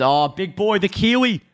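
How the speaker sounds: high, excited attempt at a New Zealand accent; bad Kiwi accent. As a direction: hype it up in a badly faked Kiwi accent. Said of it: "I should be able to do a better Kiwi accent".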